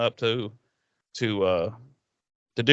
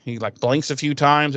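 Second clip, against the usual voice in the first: ordinary delivery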